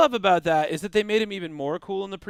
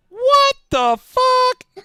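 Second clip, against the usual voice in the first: very high, slow droid squeal; R2-D2 swearing; 7 dB louder, much slower than usual. Retold or imitated. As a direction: stretch it out like a shocked little droid beeping. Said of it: "And R two D two goes, and I quote".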